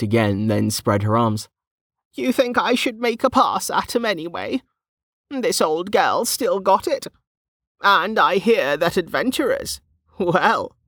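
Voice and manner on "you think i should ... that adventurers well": put-on suggestive old woman. A narrator's reading. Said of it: "she wiggled her eyebrows suggestively".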